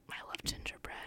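breathy whispered craving; whispering. Real, not acted. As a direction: whisper it like a secret treat. Dreamy aside about gingerbread.

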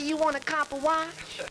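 high, slow hipster sales pitch; subway hustler character. Acted. Character offering a watch on the subway, hipster hustler. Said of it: "a character came up to me something like this".

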